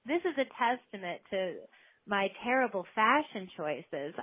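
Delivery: normal talking voice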